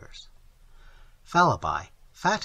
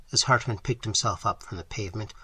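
usual speaking voice

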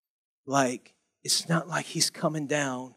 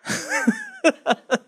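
real laugh. Real, not acted. Preacher laughs at own joke.

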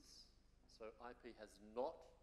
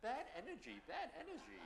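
mock warning; 13 semitones higher than usual. Mock jinx on redrawn card.

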